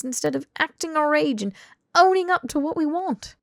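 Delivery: high, loud exasperated outburst; furious, rising tirade; barreling rant. Acted. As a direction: let it all pour out at full volume. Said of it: "Harry barreled through".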